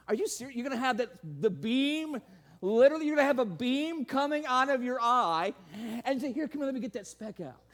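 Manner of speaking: mock the hypocrite with biting sarcasm; sarcastic mocking. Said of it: "It's sarcastic humor".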